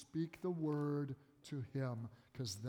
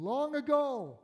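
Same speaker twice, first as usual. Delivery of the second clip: rising to a high, loud finish; emphatic declaration; 8 semitones higher, 7 dB louder than usual. Acted. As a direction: proclaim it with full conviction. Emphatic end of declaration against Satan.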